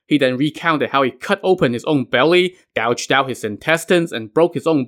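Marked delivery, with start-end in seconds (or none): none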